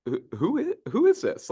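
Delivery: intrigued, not annoyed; pleasantly surprised. Retold or imitated. Client answering, curious who calls. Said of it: "the person's like ... in a good way".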